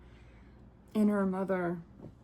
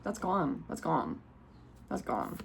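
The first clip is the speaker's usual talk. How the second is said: fading repeated release; 3 semitones lower, 4 dB quieter, slower than usual. Shown as a demonstration. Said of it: "You're allowed to get rid of things".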